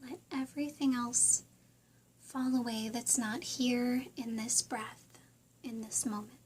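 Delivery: soft, unhurried meditation voice with long pauses; guided meditation. Real, not acted. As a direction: gently guide listeners through breathing and visualizing.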